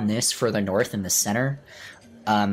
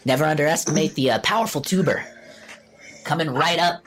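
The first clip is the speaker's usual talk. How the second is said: halfling waiter voice; 5 semitones higher than usual. Acted.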